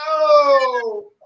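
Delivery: loud, high drawn-out falling "oh"; exaggerated exclamation to startle people away; loud mock "oh". Shown as a demonstration. Shopper making crowders get off her, loud drawn-out oh. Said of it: "I do like this sometimes".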